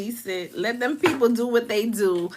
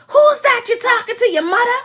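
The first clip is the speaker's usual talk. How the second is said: mad; 9 semitones higher, faster than usual. Retold or imitated. Aunt scolding her son for talking to his mother, imitated angry voice. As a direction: imitate a scolding, pitched up and rapid. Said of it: "She sound mad".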